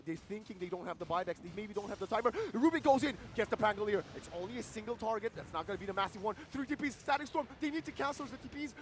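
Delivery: loud, high, hyped esports casting; excited play-by-play. Acted. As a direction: call every move with rising hype.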